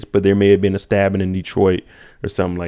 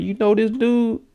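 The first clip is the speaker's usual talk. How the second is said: suspicious question; 13 semitones higher than usual. Pretend. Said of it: "I would have looked at her like".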